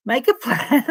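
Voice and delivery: high, loud retry collapsing into giggles; breaking into laughter. Real, not acted. Reader retrying her fluffed words, breaking into laughter. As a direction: crack up on the last word.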